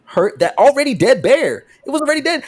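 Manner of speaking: high, incredulous retort; mock-indignant rise in pitch; exasperated arguing. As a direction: argue the obvious, worked up. Acted argument over dead bear.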